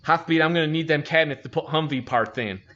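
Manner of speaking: mock Chief's lisp on every s; imitating Chief's lisp. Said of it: "he talked with a really bad lisp".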